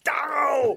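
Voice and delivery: sudden high yelp of pain. Retold or imitated. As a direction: yelp like a sharp noise hurt your ears. Wincing at a dropped plate.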